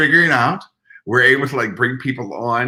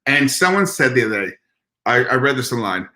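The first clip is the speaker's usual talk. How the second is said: normal talking voice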